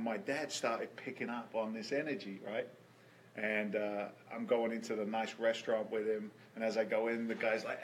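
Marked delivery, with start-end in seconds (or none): none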